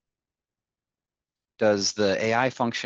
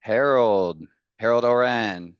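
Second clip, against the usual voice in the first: calling out a name; 3 semitones higher, 7 dB louder than usual. Real, not acted. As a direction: announce the winner's name loudly.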